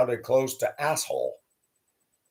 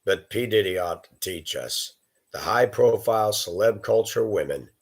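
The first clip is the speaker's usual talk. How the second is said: normal talking voice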